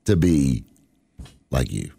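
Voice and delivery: slow, sinking mock awe; mock reverent. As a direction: drag it out with fake reverence.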